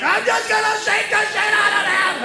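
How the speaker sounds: high-pitched strained rant; mad outburst. Retold or imitated. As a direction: imitate someone mad beyond control. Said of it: "never been so mad where I'm like".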